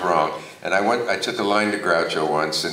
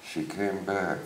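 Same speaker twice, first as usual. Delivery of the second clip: softly fed line, under the breath; sotto voce prompt; 7 dB quieter than usual. Retold or imitated. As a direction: slip a forgotten line to a partner quietly. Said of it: "you can hear sotto voce".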